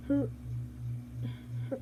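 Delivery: usual speaking voice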